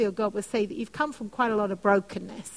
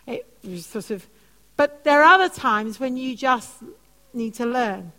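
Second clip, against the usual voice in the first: normal talking voice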